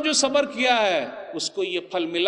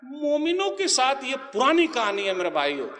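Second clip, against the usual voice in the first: ordinary talk, nothing marked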